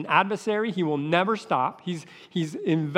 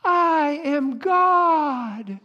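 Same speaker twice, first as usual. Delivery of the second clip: high, drawn-out mock cry, much higher and slow; mock scream; 10 semitones higher, 3 dB louder, much slower than usual. New Agers on the beach, a mocking high drawn-out cry. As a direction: ham up a proud shout to the sky. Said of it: "they're screaming".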